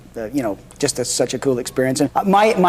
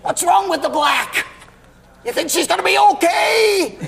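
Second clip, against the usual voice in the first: hammed-up Mickey Rooney; 12 semitones higher than usual. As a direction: ham it up like a B-movie actor. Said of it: "His acting is like this".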